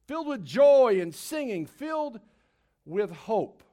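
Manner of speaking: rising, stirring sermon opening; preaching. Acted. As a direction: build the joy like a preacher. Opening of a Palm Sunday sermon.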